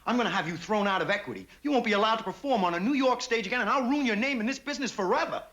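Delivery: building angry tirade, rising high; angry threat. Acted. Director threatening to ruin the actress's career, angry and rising. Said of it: "Perhaps you're angry".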